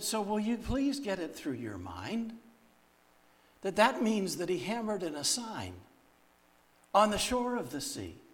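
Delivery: high, insistent retold scolding; exasperated admonishing. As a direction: plead firmly to get the point through. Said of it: "she can't yell at me again".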